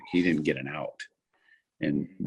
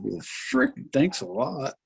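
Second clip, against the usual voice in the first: high mock-grateful retort, dripping sarcasm; sarcastic thanks; 10 semitones higher, slower than usual. Pitcher sarcastically thanking the coach who left him alone, high mock voice. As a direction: throw the thanks back at the coach, higher. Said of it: "I'm going".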